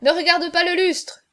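high, loud call to the drinker; cried warning. A narrator's reading. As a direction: shout advice from the sidelines. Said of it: "s'écrièrent les joueurs".

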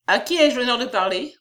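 lightly acted disdainful query; impertinent. A narrator's reading. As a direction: ask it with a touch of insolence. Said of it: "d'un air impertinent".

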